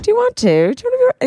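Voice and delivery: high-pitched eager squeal; squealy high question. Retold or imitated. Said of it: "She's like".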